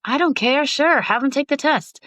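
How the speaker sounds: high, breezy brush-off; dismissive. Retold or imitated. Telling her husband she doesn't care, breezy brush-off. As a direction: wave it away carelessly.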